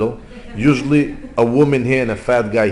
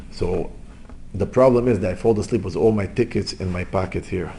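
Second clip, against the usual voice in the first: ordinary talk, nothing marked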